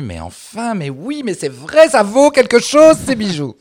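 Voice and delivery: voix qui monte très haut, lente et forte; étonnement imité. Retold or imitated. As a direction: imiter une découverte émerveillée, en montant. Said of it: "elle s'est dit". (surprise jouée, aiguë et appuyée)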